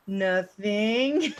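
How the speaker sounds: drawn-out word rising at the end; mock-innocent singsong admission; playfully sheepish answer. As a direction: stretch it out slowly, lilting upward. Voices own lazy answer to caller.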